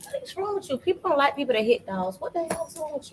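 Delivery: high, thin scolding voice. Real, not acted. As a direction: scold them in a high pitch. Scolding someone who hits a dog.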